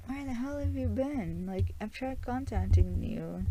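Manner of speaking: high, anxious scolding; worried girlfriend. Retold or imitated. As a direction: girlfriend who hasn't heard from him, high and anxious. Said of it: "she's concerned".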